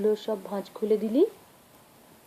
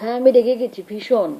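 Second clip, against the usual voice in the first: mock angry, much louder; 2 semitones higher, 10 dB louder than usual. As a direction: bark it out, playfully cross with the child. Pretend anger with a child. (বাচ্চার সঙ্গে খেলায় জোরে রাগের ভান)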